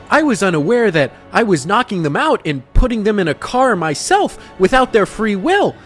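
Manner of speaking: high, exaggerated sarcastic protest; mocking sarcasm. Salesman scoffing at accusation of forcing people to play, sarcastic. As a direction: feign innocence in a high, mocking pitch.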